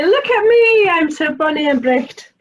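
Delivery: high, loud showing-off voice; proud little bird preening out loud; boastful bragging. Acted. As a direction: crow about your own beauty. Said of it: "he would brag and boast and say".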